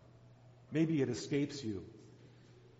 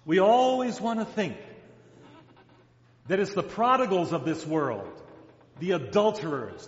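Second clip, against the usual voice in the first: louder, building preacher's list; preaching; 5 dB louder than usual. Acted. Preacher listing sinners to his congregation, building louder. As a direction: drive the list home to the congregation.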